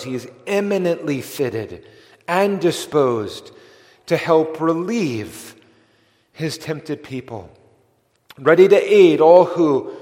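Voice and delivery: building sermon climax. Real, not acted. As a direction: let it swell to a ringing peak. Preacher swells to climax.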